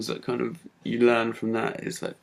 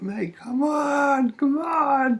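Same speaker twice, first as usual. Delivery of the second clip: very high, drawn-out rallying yell; slow, stretched cry of triumph; retold hyped shouting; 5 dB louder, much slower than usual. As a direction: re-enact whipping up a cheering crowd. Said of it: "getting louder and louder".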